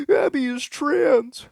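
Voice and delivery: very high, very slow mock complaint; whiny gamer accusation. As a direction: drag it out like a sulking troll. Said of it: "And another".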